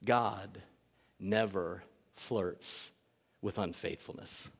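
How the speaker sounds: low, hushed and very slow; solemn statement. Real, not acted. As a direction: let each word land softly, with pauses. Solemn first statement to congregation.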